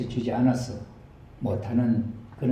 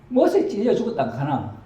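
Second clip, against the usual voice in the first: raised Gyeongsang granny voice asking; a country old woman checking the story; Gyeongsang grandma; 7 semitones higher, 4 dB louder than usual. Acted. Grandma repeats the news as a Gyeongsang dialect question. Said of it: "경상도 버전으로".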